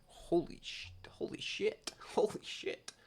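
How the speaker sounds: mock awed whisper, breath only; stunned whisper. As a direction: mouth it in disbelief like a stunned fan. Stunned reaction to huge number.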